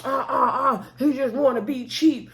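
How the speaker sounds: mock interjection, repeated grunts first; imitating Bernard piping up. Retelling Bernard butting in about Marcel, piping up. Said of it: "Bernard over there start piping up saying".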